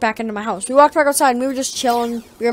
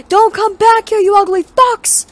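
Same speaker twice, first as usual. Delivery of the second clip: high, loud yell with fucks dragged out; screaming after them; 11 semitones higher, 7 dB louder, slower than usual. Retold or imitated. Kid yelling after the family's car, furious. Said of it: "I screamed after their car".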